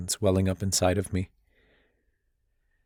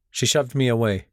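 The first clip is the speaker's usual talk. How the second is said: usual speaking voice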